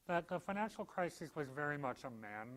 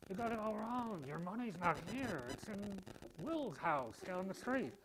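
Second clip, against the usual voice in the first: hammed-up movie-star impression, higher pitch with big swoops; Jimmy Stewart; 6 semitones higher than usual. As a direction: plead in an exaggerated old-film hero voice. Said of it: "do my Jimmy Stewart, uh, impression".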